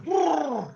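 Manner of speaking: high trilled "brrr" of gushing vomit; imitated vomiting gush. Grandfather showing how the child threw up, imitated gushing sound. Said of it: "ini dia muntah. Keluar".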